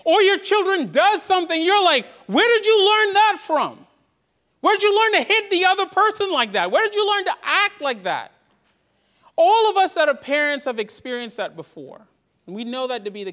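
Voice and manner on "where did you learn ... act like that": put-on high, loud scolding of a child; scolding parent. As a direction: demand it like a shocked parent.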